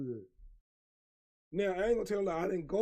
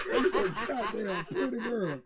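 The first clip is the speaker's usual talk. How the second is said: laughing flirt; 3 semitones higher than usual. Retold or imitated. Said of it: "I'm like".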